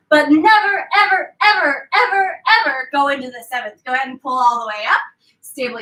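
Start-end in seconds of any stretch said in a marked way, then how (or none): 0.1-3.8 a stern fairy warning, stacking the evers; emphatic never ever. Acted. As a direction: fairies warn her off the seventh room with emphatic evers. Fairies forbid the seventh room.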